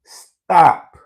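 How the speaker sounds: repeated emphatic stop. Real, not acted. Repeating the warning to viewers, loud. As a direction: hammer the word stop home. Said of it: "I repeat".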